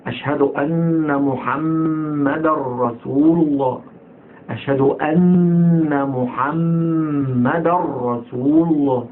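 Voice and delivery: slow chant holding the nn of أن and mm of محمد; firm doubled consonants. Shown as a demonstration. Teacher modelling the testimony, chanted with held shaddas. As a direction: lean long on each doubled letter, into the rr of رسول. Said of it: "الشد ده كويس أوي".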